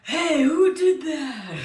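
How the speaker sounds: high, loud, slow outraged cry breaking into laughter; mock shocked yell at the prank; indignant outburst. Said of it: "then he's like".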